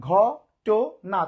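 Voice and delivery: ঘটনা split slowly as "gho - to - na"; three separate beats on ঘটনা, pausing between; syllable by syllable. Shown as a demonstration. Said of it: "তিনবারে উচ্চারণ করছে". (ঘটনা তিন ভাগে, থেমে থেমে)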